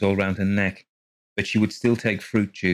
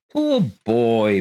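sighed; 3 semitones higher, 6 dB louder than usual. A narrator's reading. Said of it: "Mister Colgate sighed".

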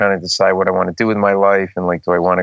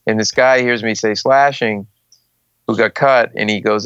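ordinary talk

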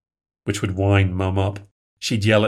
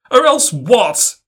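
high, loud, slow challenge; defiant challenge; 12 semitones higher, 6 dB louder, slower than usual. A narrator's reading. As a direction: dare her to say it. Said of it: "I asked".

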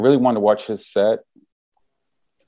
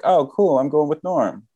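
pitched-up, eager delight; retold excitement; 7 semitones higher than usual. Fan invited along by his comedy hero, excited. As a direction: act out a higher-voiced, thrilled reaction. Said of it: "And I was excited".

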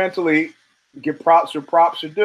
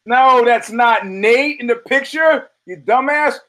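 exasperated yell; 6 semitones higher, 4 dB louder, slower than usual. Real, not acted. Yelling at chat about photo.

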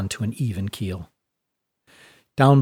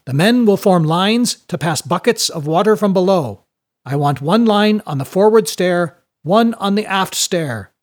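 higher, louder, projected orders; commanding announcement; 6 semitones higher, 4 dB louder than usual. Acted. Mate directing passengers into bucket lines, loud commanding voice. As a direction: organise a crowd in a raised, firm voice.

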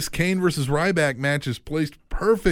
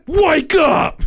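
shouting; 8 dB louder than usual. Acted. Shouting on the show.

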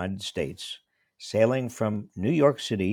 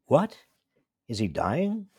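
shocked; 6 semitones higher, slower than usual. Retold or imitated. Told a film is for after his death, shocked. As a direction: retell the jolt of hearing he might be dying. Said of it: "I asked, shocked".

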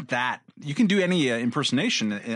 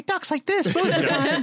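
high squeaky helium voice. Pretend. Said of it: "It's a helium balloon guy".

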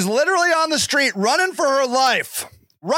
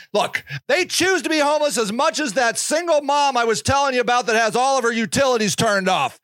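usual speaking voice

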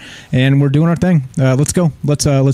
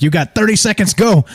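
pushy radio host; 7 semitones higher than usual. Retold or imitated. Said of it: "they're like".

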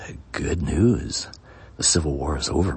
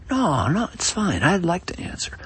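a lightly voiced witness, pitched higher; eager witness; 14 semitones higher than usual. Greene cuts in to answer the prosecutor, lightly voiced and higher. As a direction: jump in brightly to answer, higher.

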